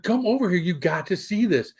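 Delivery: high-pitched urgent beckoning, retold; insistent calling out. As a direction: act out urging someone to come look. Retold calling son over, insisting.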